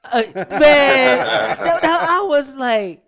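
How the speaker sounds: loud laughing through the words; can barely speak for laughing. Real, not acted. Laughing at the news.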